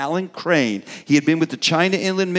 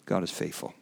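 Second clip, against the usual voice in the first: quiet, moved repeat; 10 semitones lower, 12 dB quieter than usual. Real, not acted. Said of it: "careful I don't start crying".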